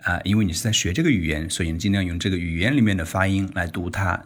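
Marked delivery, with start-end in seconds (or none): none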